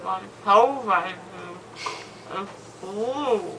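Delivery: slurred mouth full of gauze; drowsy, numb mouth talk; after wisdom teeth. Real, not acted. Still loopy with gauze in mouth.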